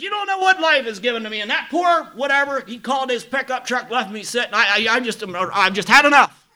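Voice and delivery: loud, high, fast bitter yell; retold furious shout. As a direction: bellow it like a man who's had enough. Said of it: "I'm so mad right now".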